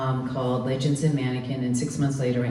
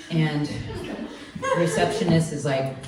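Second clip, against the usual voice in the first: ordinary talk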